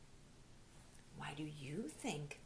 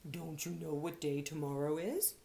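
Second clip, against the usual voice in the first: owl voice; 3 semitones lower, 5 dB louder than usual. Acted. Owl character speaking.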